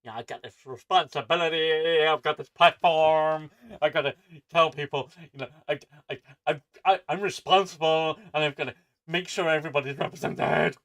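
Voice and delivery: mocking, self-important put-on voice; Brie Larson. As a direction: ham up a preachy celebrity, pushing it out loud. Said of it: "'cause Brie Larson's saying".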